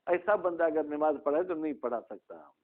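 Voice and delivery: usual speaking voice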